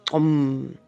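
Om. said with a click consonant snapped inside it; a San word with a click; click word demo. Shown as a demonstration. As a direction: snap a click into Om. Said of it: "porque son consonantes dentro de unas palabras".

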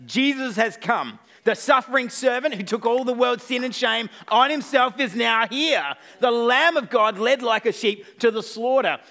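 building to a high, loud proclamation; full-force preaching. Acted. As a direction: proclaim it triumphantly, driving each phrase home. Preaches the climax as Philip.

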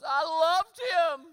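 high, slow wailing lament; high drawn-out mock wail; mock sobbing girl. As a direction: ham up a heartbroken teen crying. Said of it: "And she says".

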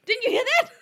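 very high, piercing shout; screaming. Acted. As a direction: shriek it at full volume. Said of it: "That noise made my ears ring".

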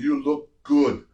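slow, lightly put-on Austrian-tinged Arnold voice; a hint of Arnold, drawn out slowly; imitating Arnold. Arnold praising the new gladiator on set, slow mock Arnold voice. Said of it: "He goes".

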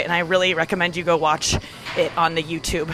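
ordinary delivery

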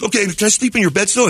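high-pitched impression of a foreign student; put-on high student voice; exchange student. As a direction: introduce yourself as the odd houseguest. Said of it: "he talks like this".

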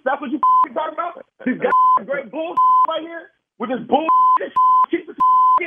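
high, loud mocking rant; angry rant. Real, not acted. Husband trashing the suit friend bought, high loud scorn. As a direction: spit it out in disgust.

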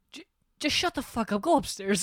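high-pitched mock scolding; imitating exasperated friend. Mimicking friend sending him upstairs, high mock scolding. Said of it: "And you're like".

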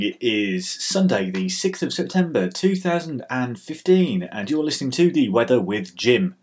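booming weather-show host intro; radio announcer. Acted.

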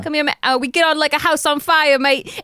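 tossing out a cheeky London-style British greeting; mock British accent. Spouse joking at bedtime in mock British accent. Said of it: "we talk in that accent all the time".

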